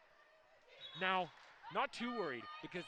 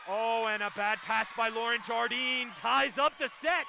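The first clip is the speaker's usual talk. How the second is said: louder, rising excited sports call; live play-by-play; 3 semitones higher, 8 dB louder than usual. Real, not acted. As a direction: call the point as it happens.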